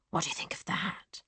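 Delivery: whispered taunt; menacing whisper. Acted. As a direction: hiss the question softly at her. Brother's menacing confession.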